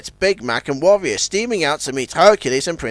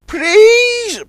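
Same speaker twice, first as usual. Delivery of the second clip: very high plea; begging; 6 dB louder than usual. A narrator's reading. Sunshine begging Zebedee, high. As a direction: beg sweetly. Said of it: "begged Sunshine".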